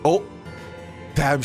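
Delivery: ordinary talk, nothing marked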